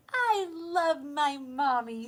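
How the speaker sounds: the littlest owl declaring love. Acted. Smallest owl speaks.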